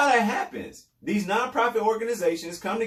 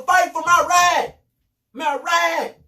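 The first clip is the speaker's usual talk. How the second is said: higher, louder mock shout of fight for my right; demanding shout; 8 semitones higher, 5 dB louder, slower than usual. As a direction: shout it like a fired-up gun owner demanding his rights. Donor demanding rights, quoted.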